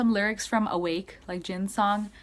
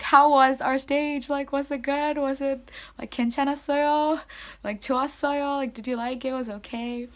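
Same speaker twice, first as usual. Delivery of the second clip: higher-pitched, eager string of questions; 6 semitones higher, faster than usual. Retold or imitated. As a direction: imitate an idol seeking the crowd's approval. Acts [name] eagerly asking the audience.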